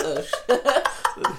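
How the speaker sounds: ordinary talk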